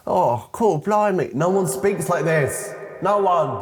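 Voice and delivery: high-pitched, hammed-up protest; mock exasperation. Exasperated rant at fake slang.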